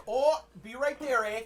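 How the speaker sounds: high, loud Minnesotan call; Minnesotan accent. Acted. Game master voicing robot answering door, Minnesotan accent. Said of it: "You're like".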